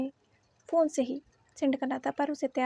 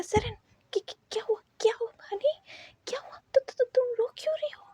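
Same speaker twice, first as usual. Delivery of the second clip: anxious whisper; 7 semitones higher, faster than usual. A narrator's reading. Husband comforts crying wife.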